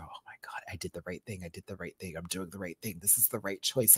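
soft, unvoiced murmur; whispered relief. Whispering to herself while watching the cats cuddle. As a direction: breathe it out in awe.

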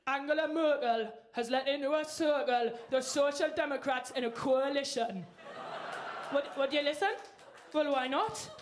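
put-on Geordie accent reading the news; mock news read, Newcastle accent; Geordie newsreader. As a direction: read a bulletin in broad Geordie. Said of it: "you'd not have us reading the news".

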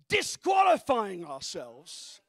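shouted, drawn-out words; slow shout. Acted. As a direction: stretch it out loudly. Preaching emphasis.

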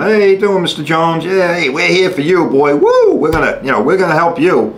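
high, oily mock-cheerful insurance agent; fake-friendly adjuster. Mocking the insurance company greeting a caller, syrupy. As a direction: play the smarmy adjuster who pretends to care. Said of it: "you call the insurance company".

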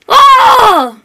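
a startled high yell, loud and short; sudden yelp. Acted. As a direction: cry out in sudden alarm. Hypnotised dummy cries out.

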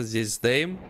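normal talking voice

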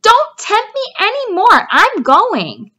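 high, loud outburst; rat cries out. A narrator's reading. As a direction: cut the sheep off sharply. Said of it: "cried Templeton".

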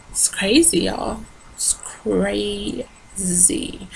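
sighing, soft and drawn out; weary sigh. Real, not acted. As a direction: breathe it out, worn down. Sighing about her pain.

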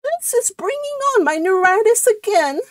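very high, put-on complaining voice; high-pitched martyred complaint; ailing cousin. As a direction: pitch it right up, like a suffering invalid. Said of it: "this is a different voice".

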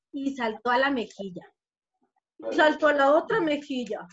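plain reading voice

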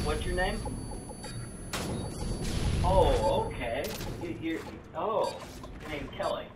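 ordinary delivery

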